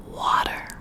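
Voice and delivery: usual speaking voice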